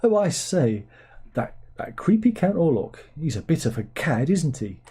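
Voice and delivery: a hint of plummy upper-class English; lightly mock toff accent; light parody of a posh Englishman. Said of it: "speaking with".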